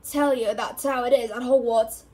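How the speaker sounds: loud, stern retort; growled. A narrator's reading. Gamekeeper cuts off boy's protest, growling. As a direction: cut him off loudly with mild growl. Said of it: "Hagrid growled".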